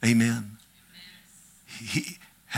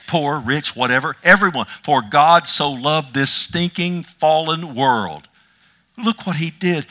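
loud, higher-pitched preacher proclaiming; impassioned preaching; 4 semitones higher, 5 dB louder than usual. Real, not acted. Preacher telling the congregation God loved this fallen world, impassioned. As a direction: preach it out at full force, pitched up.

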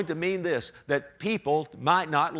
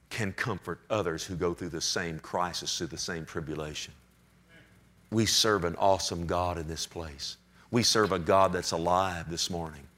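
tender pastoral voice; 10 semitones lower than usual. Real, not acted. Pastor comforting the hurting church, low and gentle.

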